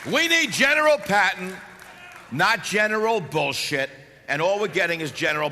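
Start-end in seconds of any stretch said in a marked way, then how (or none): 0.1-3.9 shouted slogan. Real, not acted. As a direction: punch it out to the crowd. Crowd rally, repeated slogan.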